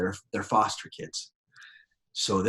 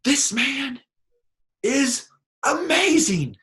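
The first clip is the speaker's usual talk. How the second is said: much higher, slow and drawn out; mock rapturous praise; 6 dB louder, much slower than usual. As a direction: gush like a thrilled stranger. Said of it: "And he's like".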